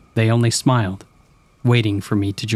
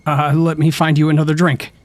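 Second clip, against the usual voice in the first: higher, louder desperate excuse; 6 semitones higher, 4 dB louder than usual. A narrator's reading. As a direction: blurt it out, desperate to escape. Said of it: "I cried, desperate to make".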